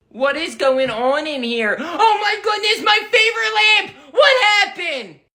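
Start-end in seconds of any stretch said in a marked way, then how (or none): 1.8-5.2 shocked mom. Acted. Mom discovering her broken lamp, high shocked cry. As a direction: cry out over your favorite lamp.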